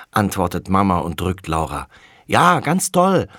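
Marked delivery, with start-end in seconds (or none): 2.3-3.3 put-on high, slow, loud small-boy voice; a little boy eagerly agreeing; little brother Tommy. Little brother chiming in to agree, high and slow. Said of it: "bekräftigt Tommy".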